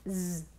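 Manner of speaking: a sustained buzzing "z"; Z sound. Shown as a demonstration. Teacher shows S between vowels, sounding a buzzed "z". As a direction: sound out "z" alone, not the letter name. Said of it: "on l'entend z".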